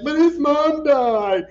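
loud, high, drawn-out mock protest; mock distress. Imitating everybody upset over Forrest's mom, mock distress. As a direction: wail it out, imitating upset viewers. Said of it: "everybody was like".

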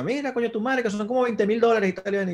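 imitando a mamá. Retold or imitated. Madre alarmada por veinte mil dólares.

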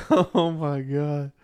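laughing through the words; gasping laugh, high. Real, not acted. Laugh heard on the line.

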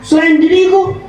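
angry pharaoh. Acted. Preacher voicing Pharaoh threatening Masyitoh, angry. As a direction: bellow the tyrant's threats.

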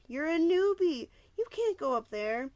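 put-on high, loud boy's protest; younger boy. A narrator's reading. Younger boy warning the newbie, high and insistent. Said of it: "the younger boy said".

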